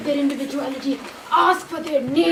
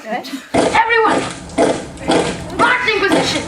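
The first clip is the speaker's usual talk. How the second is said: commanding shout; 4 semitones higher, 3 dB louder than usual. Acted. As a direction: bark it like a drill sergeant. Commander calling out orders.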